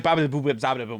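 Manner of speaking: mock fake language, no real words; gibberish language. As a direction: babble nonsense sounds like speech. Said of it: "start speaking gibberish language".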